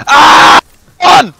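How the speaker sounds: AH yelled high, then a loud ONE; exaggerated shout. Shown as a demonstration. Player parodying his own callout to teammates, exaggerated shout. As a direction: blast out AH, ONE as a mock callout.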